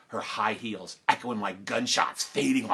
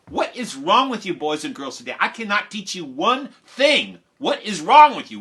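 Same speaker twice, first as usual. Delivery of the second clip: exasperated teacher, building higher and louder; Missus Nottingham, frustrated; 5 semitones higher, 6 dB louder than usual. Retold or imitated. Teacher unable to teach wired class, frustrated scolding. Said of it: "Missus Nottingham was frustrated with us".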